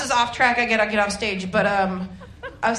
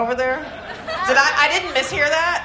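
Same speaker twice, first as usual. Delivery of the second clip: ordinary talk, nothing marked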